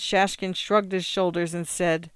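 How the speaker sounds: usual speaking voice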